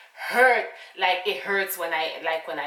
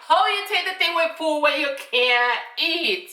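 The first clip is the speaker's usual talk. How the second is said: exasperated; 8 semitones higher, 5 dB louder than usual. Real, not acted. Rant about medicine needing food.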